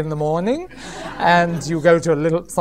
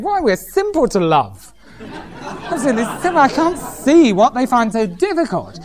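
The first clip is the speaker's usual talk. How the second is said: mock smug husband; 4 semitones higher than usual. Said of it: "most of us think".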